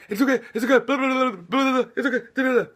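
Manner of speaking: high, rushed panicky "it's okay" blah; mock a flustered parent; high anxious babble; frantic soothing parent. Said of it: "when you're going".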